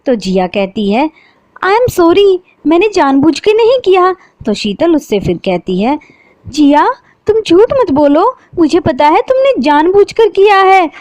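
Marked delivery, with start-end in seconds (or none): 1.6-4.1 high, louder insincere sorry; fake apology. A narrator's reading. Jiya fakes an apology to Sheetal, insincere. 6.5-10.9 high, louder accusing voice; hurt accusation. A narrator's reading. Sheetal accuses Jiya of hurting her deliberately, hurt.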